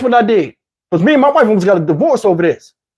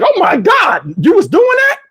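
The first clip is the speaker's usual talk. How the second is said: higher mock gasp of disbelief; shocked mother; 10 semitones higher than usual. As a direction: react like a shocked mom.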